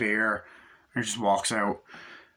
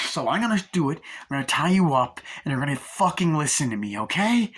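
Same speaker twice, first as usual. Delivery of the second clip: raised, forceful villain orders; threatening order; 5 semitones higher, 4 dB louder than usual. Retold or imitated. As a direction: lay down the law as the kidnapper. Acting out villain ordering hostage.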